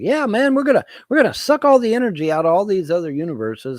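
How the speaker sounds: high, loud, mock-eager hype man; Geordie Rose. Imitating Geordie Rose on stage with wireless headset, mock-eager. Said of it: "And he's like".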